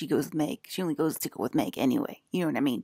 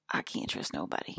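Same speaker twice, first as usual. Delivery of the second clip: low unvoiced whisper; 14 semitones lower, 6 dB quieter than usual. Repeated quote, whispered.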